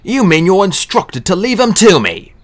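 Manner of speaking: stung magician hitting back at his host; snapped. Acted. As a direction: snap it back at him. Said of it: "Dee snapped".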